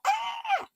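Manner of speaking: very high, squealing ah; excited squeal. Retold or imitated. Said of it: "So I'm like".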